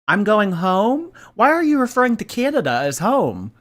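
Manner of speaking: streamer mocks her saying Canada is home, incredulous; incredulous mock echo. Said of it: "I thought that this was your home".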